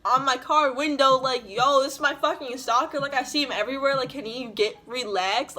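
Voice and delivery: retold angry yelling about the man. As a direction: yell about the stalker to the camera. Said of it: "a video of me yelling".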